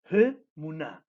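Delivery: "He muná" with a strong, aspirated HE; strong first syllable. Shown as a demonstration. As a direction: hit the breathed HE, then finish muná. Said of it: "una primera como entonación fuerte".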